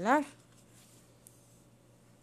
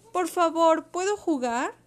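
high, loud, polite request; shouted plea; 8 semitones higher, 5 dB louder than usual. A narrator's reading. Brave little Eoraptor asks selfish Pili for a turn, shouting. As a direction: call it out strongly, pleading to join in. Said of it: "gritando tan fuerte como pudo".

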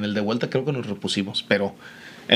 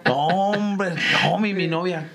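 player groaning in mock dismay, pitched higher; 5 semitones higher, much slower than usual. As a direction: lament it like the kid on the bench.

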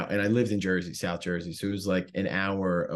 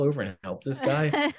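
the question laughed out, higher; amused at himself, pitched up; laughing; 6 semitones higher than usual. Real, not acted. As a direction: chuckle through the question. Laughing at his own selfishness.

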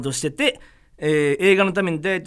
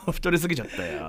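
normal talking voice

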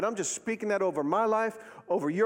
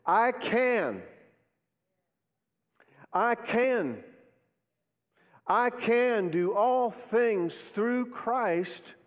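slow, higher-pitched, ringing conviction; emphatic declaration; 6 semitones higher, much slower than usual. Shown as a demonstration. Preacher urging congregation to mean it, emphatic declaration. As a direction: say it like you mean it, spaced out. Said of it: "say it like you mean it".

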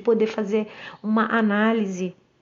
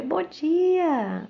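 cheery greeting reply; 6 semitones higher than usual. Retold or imitated. She answers the neighbour's greeting, bright and high. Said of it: "Aí".

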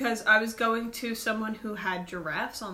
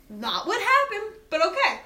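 sarcastic; 9 semitones higher than usual. Retold or imitated. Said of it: "I was like".

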